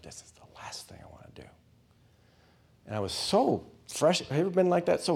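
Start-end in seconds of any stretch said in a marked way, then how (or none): 0.0-1.4 quick, voiceless whisper of reluctance; resentful whisper. Retold or imitated. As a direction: hiss out the thought under the breath. Resentful thought after the call.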